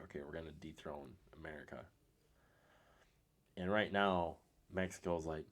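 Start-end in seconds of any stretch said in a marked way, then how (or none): 0.0-1.8 conspiratorial whisper; mock villains plotting in a whisper; scheming whisper. Russia and China scheming.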